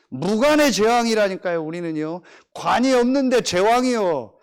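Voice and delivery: loud, slow, emphatic sermon delivery; full-throated preacher's proclamation; preaching at full force. Acted. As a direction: hammer each phrase to the congregation.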